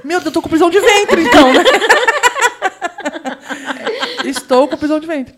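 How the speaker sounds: half-laughing mock panic; laughing alarm. Girl thinking she's constipated, laughing. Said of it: "inner thought retold".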